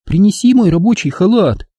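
high, insistent character voice; Angela's voice. A narrator's reading. Angela sending him for her work robe, high voice. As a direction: order it in a high woman's voice.

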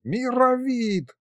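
high, drawn-out yell of a name; long high-pitched call; bellowing. A narrator's reading. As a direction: holler it like an old man calling across the house. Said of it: "заорал дед".

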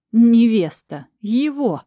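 loud, outraged exclamation. Acted. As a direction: spit it out in disgust. Vitalik's furious thought. (возмущённо, с яростью)

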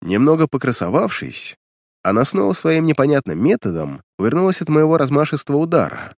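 ordinary delivery, nothing marked

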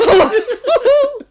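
high, loud cackle; loud burst of laughter. Acted. Servant bursts laughing at his own joke, loud cackle. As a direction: explode with laughter.

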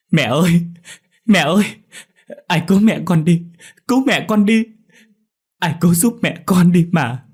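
high, loud sobbing screams; heart-rending wailing. A narrator's reading. Dậu before the flames, begging help, wailing. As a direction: beg anyone for help through tears. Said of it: "Nó gào khóc thảm thiết".

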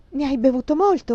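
high, loud anxious question; panting alarm. A narrator's reading. Frightened woman asks how much he drank, panting alarm. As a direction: gasp the question urgently.

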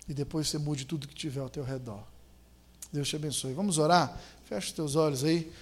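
ordinary delivery

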